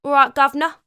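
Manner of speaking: loud, cheery Cockney greeting; Cockney accent. Pretend. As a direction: play Kingston as a London Cockney, jaunty and loud. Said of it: "Don't know if Kingston had a Cockney accent".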